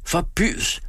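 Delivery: high, indignant outcry; acted scream of protest. A narrator's reading. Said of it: "skreg han og vækkede drengen".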